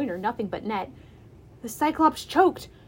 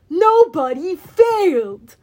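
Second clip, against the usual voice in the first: loud, slow, higher-pitched monster gloat; gleeful yell; 5 semitones higher, 9 dB louder, slower than usual. A narrator's reading. Cyclops taunts the hero who escaped nowhere, gleeful yell. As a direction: draw out the taunt as a triumphant giant. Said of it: "he yelled gleefully".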